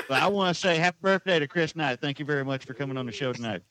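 put-on Southern showman voice. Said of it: "so there's your [name] voice".

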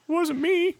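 very high, squeaky mock excuse. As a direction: whine it high like a cartoon. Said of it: "by hanging up".

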